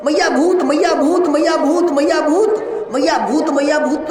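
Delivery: mock-terrified girl calling mama again and again; crying out in fright. Scared gopi wakes and cries to her mother, frightened wail. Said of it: "जोर से रोने लगी".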